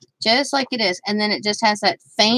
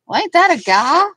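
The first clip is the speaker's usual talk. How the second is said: husband quoted; 6 semitones higher, 5 dB louder than usual. Retold or imitated. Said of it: "he goes".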